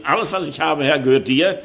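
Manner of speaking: ordinary talk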